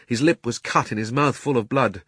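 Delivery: ordinary delivery, nothing marked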